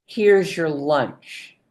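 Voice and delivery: slow and simple, each word clear; calm slow statement; slow, one short sentence. Shown as a demonstration. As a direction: lay the sentence down slowly. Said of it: "And once they sit down".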